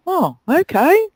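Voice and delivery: high, slow, drawn-out "oh, okay"; mock reluctant host. Reluctant host answering the guest, high and slow. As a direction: mimic a host caught off guard. Hosts asked to switch TV off.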